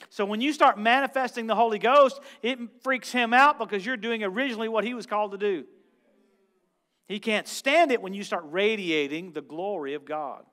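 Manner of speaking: loud, fervent pulpit delivery; preaching at full force. Acted. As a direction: preach it out hard to the congregation. Preacher pressing the point.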